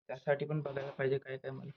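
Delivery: normal talking voice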